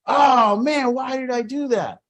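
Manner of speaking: high, loud, dismayed cry of regret; panicked outburst. Retold or imitated. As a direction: blurt it out in shock right after the crash. Imitating panicked driver after crash.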